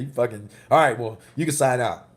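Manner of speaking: mock the doctor sulking like a kid; mocking a sulky doctor. Retelling the sulking doctor who gave up, mildly mocking. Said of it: "all pissed off like a little kid".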